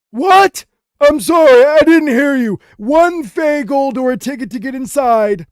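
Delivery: high, loud goblin voice, slowing at the end; an old goblin straining to hear; deaf old goblin. Acted. An old goblin at the gate, still deaf, high and loud. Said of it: "he turns to you and he says".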